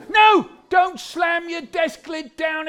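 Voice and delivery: high, loud scolding shout; outraged schoolteacher cry; teacher's yell. Acted. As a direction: shout a sharp telling-off across the classroom. Teacher yelling at pupils in story.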